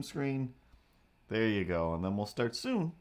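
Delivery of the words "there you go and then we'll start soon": ordinary talk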